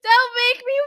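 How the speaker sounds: high whine through laughter; laughing protest. Real, not acted. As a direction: beg while laughing.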